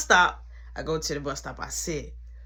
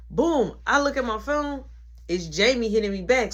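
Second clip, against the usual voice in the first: usual speaking voice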